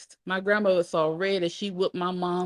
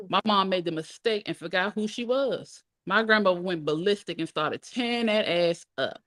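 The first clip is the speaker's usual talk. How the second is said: plain reading voice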